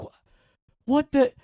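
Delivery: high, cut-off what the; stunned guest reacting to unexpected generosity, high and halting; stunned disbelief. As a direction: caught off guard by kindness.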